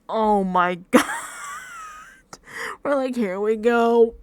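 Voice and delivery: loud, drawn-out exasperation with a laugh; exasperated groaning. Retold or imitated. Reacting with mom to wandering contestants, exasperated.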